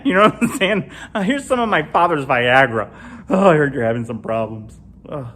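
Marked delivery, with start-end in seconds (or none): none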